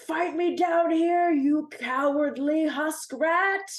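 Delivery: loud, slow, challenging yell at the enemy; shouted taunt. Acted. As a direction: bellow up at the robot to come fight. Werewolf character taunting from below.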